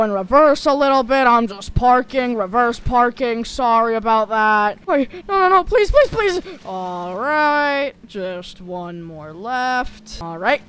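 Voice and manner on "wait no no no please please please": high, loud frantic begging; desperate pleading. Acted. Ragdoll begging again.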